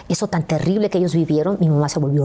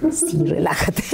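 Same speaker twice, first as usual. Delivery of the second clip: whisper; 2 semitones higher, slower than usual. Retold or imitated. Whispering to [name].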